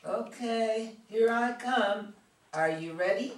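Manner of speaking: very loud, stretched-out shout; big, drawn-out offstage call. Acted. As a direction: holler from another room to announce yourself. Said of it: "Karen's voice comes from off stage".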